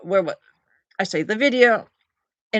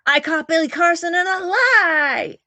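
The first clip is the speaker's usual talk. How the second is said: high, loud, drawn-out singsong call; video hook; 9 semitones higher, 5 dB louder than usual. Acted. Streamer performing the hook of an old debunking video, loud singsong. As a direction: belt it out like a catchphrase, stretching the ending. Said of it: "that's my hook".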